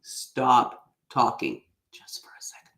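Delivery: whispered stage direction to herself; whispered self-reminder. Retold or imitated. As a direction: hush yourself mid-speech. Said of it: "it's like in parentheses".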